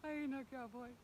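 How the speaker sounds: tossing out the catchphrase with a grin; Dale Brisby catchphrase. Retold or imitated. Rider quoting Dale Brisby's line to her viewers, lightly put-on cowboy voice. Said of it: "I got my Dale Brisby".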